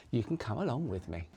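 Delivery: ordinary talk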